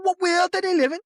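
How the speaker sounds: broad Liverpool accent slipping out mid-rant; Scouse accent. Real, not acted. Venting to co-hosts about the promotion, broad Scouse accent. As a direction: let the Scouse come through as you vent. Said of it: "You went so scouse just then".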